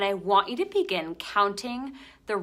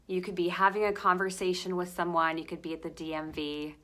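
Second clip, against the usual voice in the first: usual speaking voice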